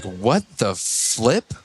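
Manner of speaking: slow, low, stretched-out disbelief; taken aback. Retold or imitated. Contractor recalls a client balking at the price, taken aback. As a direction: draw each word out, stunned. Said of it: "it was a step back".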